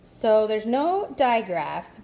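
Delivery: ordinary talk, nothing marked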